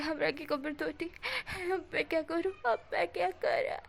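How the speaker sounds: hammed-up tearful wail; mock crying. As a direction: ham up the crying over a sore back. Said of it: "wing बोलता है".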